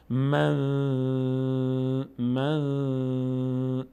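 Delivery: من with a long hummed ن; held nasal noon. Shown as a demonstration. Teacher showing how long to stay on the noon, held nasal hum. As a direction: linger on the ن of من in the nose.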